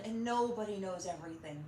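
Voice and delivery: normal talking voice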